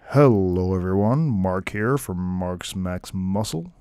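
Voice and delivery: put-on a poor try at Mark's accent, slow; lightly faked accent, drawn out; mock accent of a viewer. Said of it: "I can't do your accent, man".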